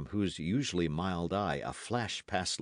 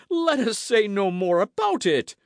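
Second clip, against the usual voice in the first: high, loud "let us say no more about it"; indignant mocking echo; 11 dB louder than usual. A narrator's reading. Porthos quotes Aramis back at him, indignant. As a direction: hurl the phrase back in outrage.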